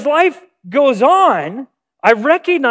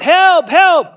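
high, loud cry for help; 8 semitones higher, 6 dB louder than usual. Retold or imitated. A kid on the ground calling the recess lady, high and loud. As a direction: yell it like a kid pinned down. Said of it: "I am crying out".

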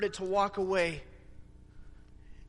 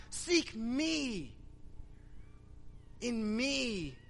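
higher, impassioned sermon delivery; fervent preaching; 6 semitones higher than usual. Acted. Pastor retelling Jesus to congregation, fervent preaching. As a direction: preach the scene out with fire.

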